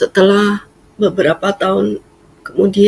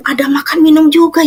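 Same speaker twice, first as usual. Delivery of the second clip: excited mom; 10 semitones higher, 4 dB louder, faster than usual. Retold or imitated.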